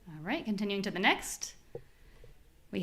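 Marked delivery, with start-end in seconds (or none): none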